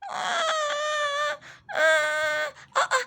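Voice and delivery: playful fake baby wail; mock baby crying. Mother faking the second doll's cry, playful wail. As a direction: cry like a toy baby, hammed-up.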